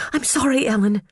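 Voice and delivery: put-on high, panicky girlish voice; nervous high little voice; timid young secretary. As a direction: squeak out an anxious apology. Phoebe's timid voice.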